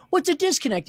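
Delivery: high-pitched retold bewilderment; baffled question. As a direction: replay your clueless question, incredulous. Repeats his baffled reply.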